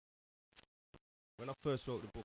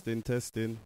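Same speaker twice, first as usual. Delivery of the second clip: loud mic test; 16 dB louder, slower than usual. Real, not acted. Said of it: "Go on then, speak".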